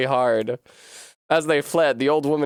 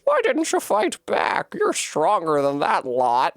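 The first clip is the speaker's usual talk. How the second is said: slow, high Irish grandma lilt; Irish grandmother, slow and concerned; Irish old woman; 5 semitones higher, slower than usual. Acted. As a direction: coax her gently in an Irish lilt. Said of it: "maybe she is Irish".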